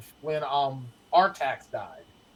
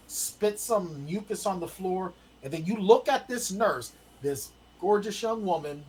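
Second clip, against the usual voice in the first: ordinary talk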